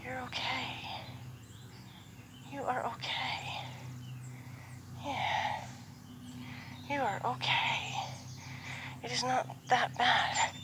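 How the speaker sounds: soft and slow, hushed comfort; gentle reassurance. Real, not acted. Trainer calming a jumpy horse, soft slow reassurance. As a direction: settle her down softly.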